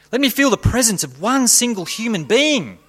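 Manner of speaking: anguished high plea; desperate plea. Acted. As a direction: beg for one human presence. Man begs God in darkness.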